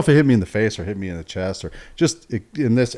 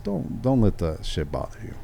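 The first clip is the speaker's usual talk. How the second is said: mild calming; 7 dB quieter than usual. Retold or imitated. Inmate easing an angry officer's mood, mildly calming. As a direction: gently play down the trouble, softer.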